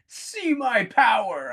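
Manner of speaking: high, slow, grandiose villain cry; booming demon lord. Pretend. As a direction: proclaim your power like a mad god. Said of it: "Red is probably in the flames going".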